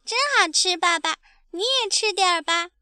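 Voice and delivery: put-on high, sweet child's voice; happy baby dinosaur. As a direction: offer food to daddy eagerly. Baby happily offers food to father. (开心的小恐龙童声)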